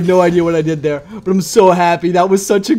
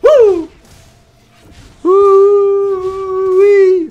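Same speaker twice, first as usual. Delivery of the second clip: 1 whooping celebration; 8 dB louder, much slower than usual. Real, not acted. Celebrating the kill.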